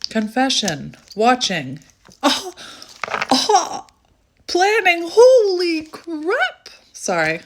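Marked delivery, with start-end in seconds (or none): 2.2-6.7 shocked. Real, not acted. Card reveal shocks reader.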